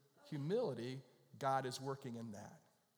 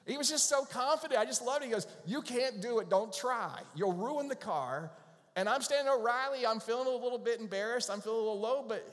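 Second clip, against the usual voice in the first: much higher and louder, animated storytelling; excited retelling; 9 semitones higher, 7 dB louder than usual. Real, not acted. Pastor retelling the parts store moment, loud and animated. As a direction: tell it with rising, amused energy.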